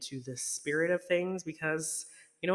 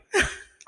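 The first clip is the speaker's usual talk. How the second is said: short breathy laugh; 5 dB louder than usual. Real, not acted. Laughs at own joke.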